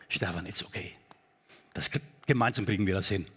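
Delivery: tender reassurance. Retold or imitated. Trusted person reassures Stefan.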